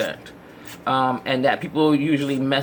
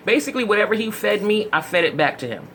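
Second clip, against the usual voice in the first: normal talking voice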